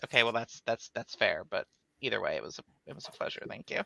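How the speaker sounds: flustered mumbled farewell. Acted. Flustered goodbye to charming stranger.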